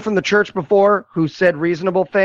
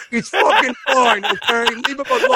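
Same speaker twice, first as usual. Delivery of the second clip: higher, exasperated protest; mock defensive outburst; 6 semitones higher than usual. Film protesting too much about the kid, mock defensive outburst. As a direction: snap it as an overblown defense.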